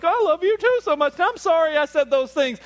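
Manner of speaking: very high, loud gushy mock reply; squeaky mock-tender I love you too; gushing reply. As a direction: overplay the sweet make-up answer.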